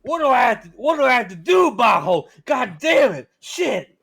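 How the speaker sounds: loud, high, mock-frustrated yelling; mock shout. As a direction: yell in fake exasperation at the chat. Mock outburst about subscribers.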